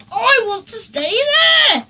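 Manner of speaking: high, loud whining demand; a spoilt boy insisting; Henry demanding the noisy campsite. A narrator's reading. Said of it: "said Henry".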